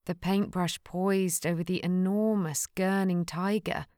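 usual speaking voice

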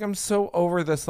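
normal talking voice

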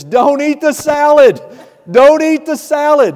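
high, loud panicked warning; urgent shout. Retold or imitated. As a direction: yell it across the dining hall to stop the kids. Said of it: "Running into the dining hall".

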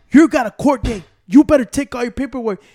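loud, stern official telling him off. Retold or imitated. Retelling what animal control told him, loud and stern. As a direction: lay down the rules loudly.

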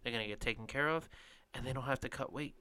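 ordinary talk

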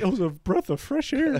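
a lightly voiced old person, quiet and content. Praising a movie like an old man, lightly voiced. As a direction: sigh it like an old-timer at peace. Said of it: "I was like an old person".

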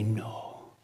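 soft, slow whisper; hushed urging. Retold or imitated. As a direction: lean in and whisper the plea. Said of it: "he would say".

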